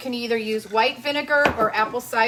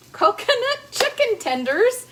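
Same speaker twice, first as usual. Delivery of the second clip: laughing; 11 semitones higher, 5 dB louder than usual. Real, not acted. Laughs at own slip.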